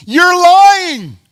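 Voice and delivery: high, loud exclamation; calling it out. Acted. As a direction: bursts out in mock accusation. Preacher calling out brothers' claim.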